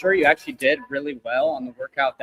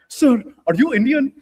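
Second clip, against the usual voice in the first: much higher, louder Indian accent imitation; imitating an Indian accent; 9 dB louder than usual. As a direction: do the Indian caller, pitched way up. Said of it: "the guy on the other line was Indian".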